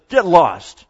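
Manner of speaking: loud, curt acted dismissal; bitter and angry. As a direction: throw it out forcefully, angrily. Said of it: "She's angry".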